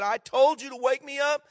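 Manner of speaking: loud, high angry outburst at the flight attendant; irate traveller raising his voice; furious passenger. Retold or imitated. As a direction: yell it, fed up and betrayed. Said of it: "and he said".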